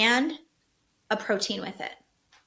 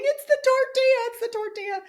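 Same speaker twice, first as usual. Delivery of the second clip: put-on kids screaming; 13 semitones higher, 4 dB louder than usual. Brothers screaming in the back seat about the tortilla, high giddy shriek. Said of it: "they start screaming in the back and laughing".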